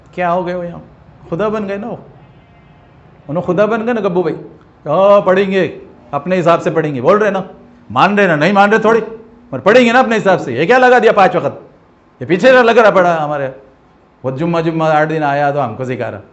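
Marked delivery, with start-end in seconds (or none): 4.9-13.5 annoyed grumble. Retold or imitated.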